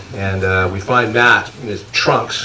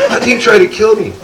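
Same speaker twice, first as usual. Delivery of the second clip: high, pushed complaint; indignant alarm; 11 semitones higher, 4 dB louder than usual. Retold or imitated. Matt telling his rescuers about the llama, high indignant alarm. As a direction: protest like you barely escaped. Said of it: "He goes".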